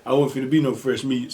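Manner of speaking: usual speaking voice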